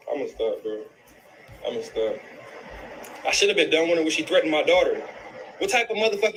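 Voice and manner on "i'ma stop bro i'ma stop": choked-up quiet. Real, not acted. Breaking off, heartbroken about mother.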